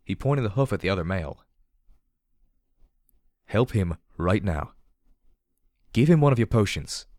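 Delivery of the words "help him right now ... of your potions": firm. Acted. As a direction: order it firmly. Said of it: "P twenty-one said firmly".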